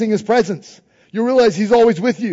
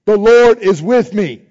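louder each time, leading the crowd; loud declaration; 2 semitones higher, 8 dB louder, slower than usual. Shown as a demonstration. Preacher leading congregation in chant, loud declaration. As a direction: call it out for all to repeat. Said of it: "Try it".

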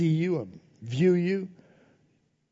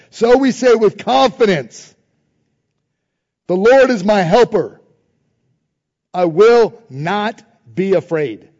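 louder, slow on the final line; emphatic scripture reading; 6 dB louder, slower than usual. Acted. Preacher reading the verse aloud, emphatic proclamation.

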